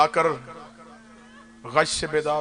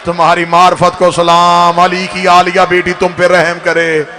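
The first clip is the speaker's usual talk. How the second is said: loud, rising oratory to the mourners; impassioned majlis preaching; 8 dB louder than usual. Acted. Preacher to the majlis, full-voiced impassioned recitation.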